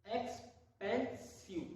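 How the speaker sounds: Expensive stretched out very slowly; slow demo. Shown as a demonstration. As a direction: draw out expensive syllable by syllable. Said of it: "बोल देखिए". (Expensive बहुत धीरे खींचकर)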